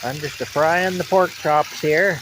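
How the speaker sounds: hillbilly drawl, "a frying" with an extra syllable; folksy "a-frying" drawl. Pretend. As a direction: drawl out "a frying" like a country cook. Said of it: "I'm a frying them up".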